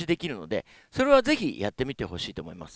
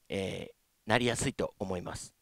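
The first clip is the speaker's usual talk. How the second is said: normal talking voice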